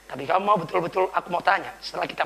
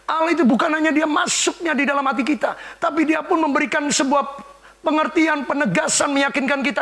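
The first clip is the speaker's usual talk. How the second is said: high, loud preaching; full-force preaching; 9 semitones higher, 6 dB louder than usual. Real, not acted. Preacher proclaiming to the congregation, loud and high.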